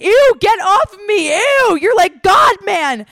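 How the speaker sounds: high, loud mock outrage; disgusted protest. Friend pushing the grabby guy away, loud disgust. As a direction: imitate a girl shrieking him off. Acted friend fending off the boy.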